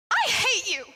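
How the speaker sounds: sharp furious yell; angry shout. Acted. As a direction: spit it out in anger. Lovers' quarrel, shouting.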